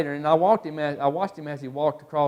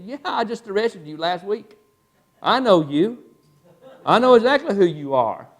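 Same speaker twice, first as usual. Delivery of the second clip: raised pitch, emphatic and increasingly loud; insistent police officer; 5 semitones higher, 6 dB louder than usual. Retold or imitated. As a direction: press the suspect like a cop who is certain. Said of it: "giving him that police officer look".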